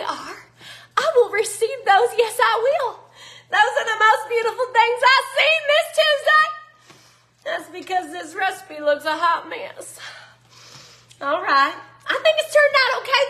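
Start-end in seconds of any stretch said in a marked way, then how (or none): 1.0-6.5 high and loud, thrilled, laughing at the end; beaming, laughing thanks; gushing delight. Real, not acted. Gushing over the bread hearts. 12.1-13.2 pleased surprise. Real, not acted. Surprised the batter worked.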